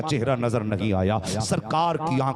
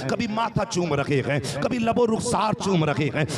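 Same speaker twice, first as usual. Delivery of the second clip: soaring, fervent preacher's cadence, pitched higher; impassioned preaching; 4 semitones higher than usual. Acted. Preacher carrying the gathering through a mother's grief, fervent. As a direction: pour the story out at full force to the crowd.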